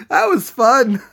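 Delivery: high, loud, through the tail of a laugh; giddy post-prank exclamation; laughing delight. Real, not acted. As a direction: burst out gleefully after cracking up. Cracking up after the prank.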